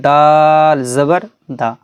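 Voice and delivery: long দাল, then a quick short দা; short final syllable. Shown as a demonstration. Teacher repeats how it was read above, short final দা. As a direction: hold দাল and cut the last দা short. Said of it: "যেরকম পড়েছি".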